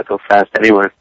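ordinary talk

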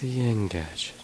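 breathy whispered echo; whispering. Pretend.

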